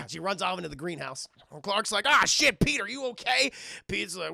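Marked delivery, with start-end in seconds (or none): 2.1-3.5 high, loud imitated alarm; acted urgent worry; alarmed concern. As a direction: shout to check on a fallen friend. Rescuer checks on fallen friend.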